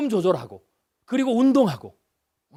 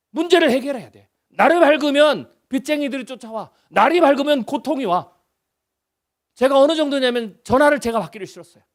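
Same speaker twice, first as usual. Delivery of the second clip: ordinary talk, nothing marked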